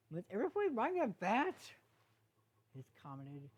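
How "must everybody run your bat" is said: exasperated. Acted. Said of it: "He rolled his eyes and sighed".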